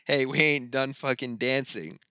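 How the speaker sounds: lightly voiced character calling out over a party; imitating Jane. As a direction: call it out loudly, as if quoting her. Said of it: "you just hear Jane's voice saying".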